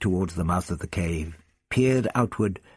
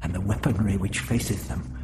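muttered; 5 semitones higher than usual. A narrator's reading. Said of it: "he muttered".

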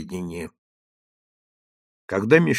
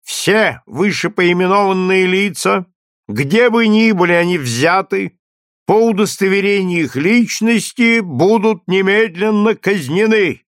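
loud, higher stern proclamation; town crier; 7 semitones higher, 4 dB louder than usual. A narrator's reading. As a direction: proclaim the sentence to the square. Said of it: "снова стал читать".

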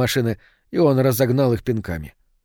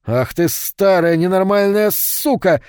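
slow, higher, louder furious yell; roaring back; 7 semitones higher, 4 dB louder, slower than usual. A narrator's reading. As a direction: bellow the insult at her. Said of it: "заорал в ответ Рико".

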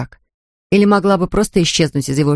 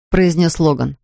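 ordinary delivery, nothing marked

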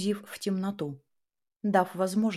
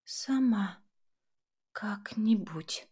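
weak, slow whisper; barely audible whisper; 2 semitones higher, much slower than usual. Acted. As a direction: breathe it out with no strength left. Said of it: "Она чуть слышно прошептала".

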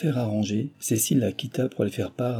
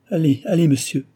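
higher, louder alarmed urging; alarmed cry; 7 semitones higher, 5 dB louder than usual. A narrator's reading. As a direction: cry out in alarm to send him off. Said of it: "s'écria Cécile alarmée".

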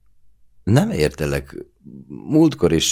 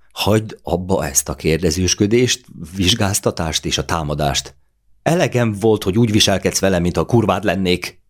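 fed-up outburst; 5 semitones higher than usual. A narrator's reading. Claudia, fed up, lashing out at her lover's questioning. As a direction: let the built-up anger break out.

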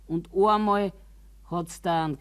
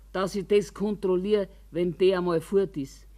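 normal talking voice

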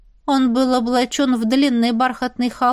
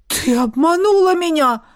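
raised, wailing voice of the baron; horrified; 5 semitones higher than usual. A narrator's reading. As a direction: cry out aghast at the deceit. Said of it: "Ужаснулся барон".

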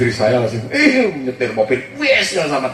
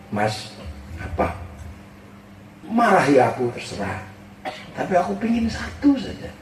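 much softer, gentle asking tone; soft pleading wife; 5 semitones lower, 6 dB quieter than usual. Retold or imitated. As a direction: hold his hand and ask softly. Wife pleading softly, holding his hand.

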